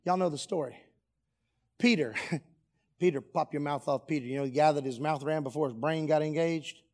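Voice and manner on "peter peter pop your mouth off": ordinary talk, nothing marked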